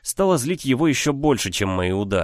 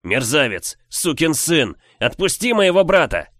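furious shout, louder and higher; yelling; 6 semitones higher, 4 dB louder than usual. Acted. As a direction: scream the insults in rage. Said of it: "Продолжая кричать".

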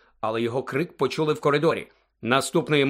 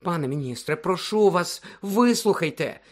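high, begging plea; pleading; 6 semitones higher than usual. A narrator's reading. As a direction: implore him to listen. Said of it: "благально".

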